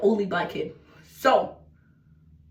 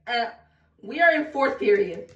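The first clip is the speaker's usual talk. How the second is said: ordinary delivery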